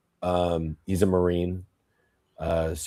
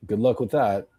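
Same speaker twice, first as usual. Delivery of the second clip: normal talking voice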